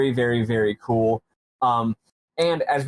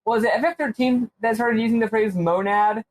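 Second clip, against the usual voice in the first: high, raised voice to someone away; calling out; 9 semitones higher than usual. Real, not acted. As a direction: call the question across the room. Calling out to partner off-mic.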